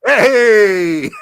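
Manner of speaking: loud happy greeting shout; excited shout. Real, not acted. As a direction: call out her arrival loudly. Greets co-host joining stream.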